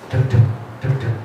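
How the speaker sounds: whispered heartbeat imitation; heartbeat sounds. Shown as a demonstration. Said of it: "Pegang dadanya".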